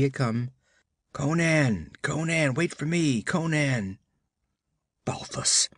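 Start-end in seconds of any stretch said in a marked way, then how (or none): 1.1-4.0 faint calling. Acted. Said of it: "a voice called faintly back". 5.0-5.7 startled whispered oath; bewildered oath. Acted. Conan swearing in surprise at the voice, whispered oath. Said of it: "he swore bewilderedly".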